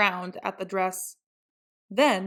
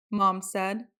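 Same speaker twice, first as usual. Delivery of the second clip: ordinary delivery, nothing marked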